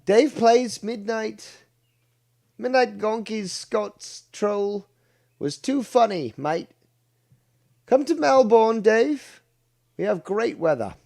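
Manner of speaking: high, loud attempt at an Australian accent. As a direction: read it out as an exaggerated Aussie. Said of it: "I'll try to do an Australian accent".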